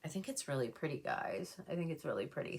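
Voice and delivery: soft and teary, voice catching; holding back tears while praising it; choked up. Real, not acted. Vlogger tearing up over the palette to viewers, softly choked up. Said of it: "Sorry, I didn't mean to get emotional".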